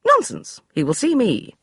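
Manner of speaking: high, brisk dismissal; imperious lady; commanding lady. Acted. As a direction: brush him aside like a general. Beatrice voiced high.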